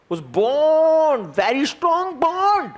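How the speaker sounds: "Bond" and "bond" hugely drawn out, rising high; dramatic emphasis. Shown as a demonstration. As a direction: stretch "bond" and "bond" long to show strength. Teacher dramatizes the bond's strength. ("Bond" और "bond" को ऊँचे सुर में खूब खींचकर)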